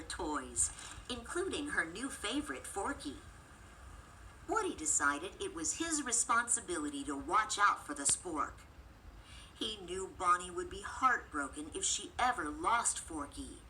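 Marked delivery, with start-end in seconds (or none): none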